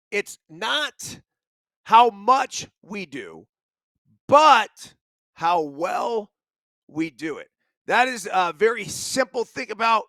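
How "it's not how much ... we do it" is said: every word slowed and weighed; slow deliberate quote. Shown as a demonstration. Host reading the quote of the day, slow and deliberate. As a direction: read the quote slowly, letting each phrase land.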